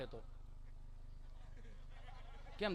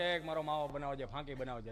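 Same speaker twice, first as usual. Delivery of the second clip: customer at stall; 7 semitones lower, 6 dB quieter than usual. Retold or imitated. Father orders at shop, acted.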